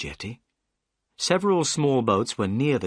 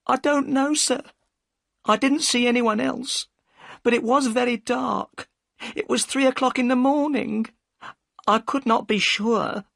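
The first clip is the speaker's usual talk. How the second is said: high, anxious woman's voice; a worried wife pushing him away; upset boatman's wife; 13 semitones higher, 3 dB louder than usual. A narrator's reading. Mrs Smith answering Holmes.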